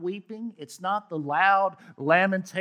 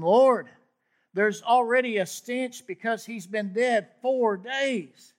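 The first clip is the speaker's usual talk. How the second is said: loud, higher urgent objection; alarmed protest; 5 semitones higher, 7 dB louder than usual. Acted. Martha warns Jesus at the tomb: loud alarmed protest. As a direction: push back hard, voice raised.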